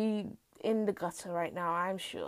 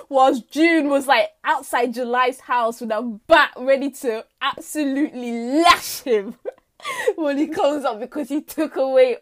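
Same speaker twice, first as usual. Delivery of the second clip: usual speaking voice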